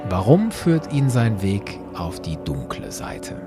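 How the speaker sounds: normal talking voice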